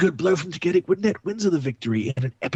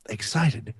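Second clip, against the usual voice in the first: ordinary talk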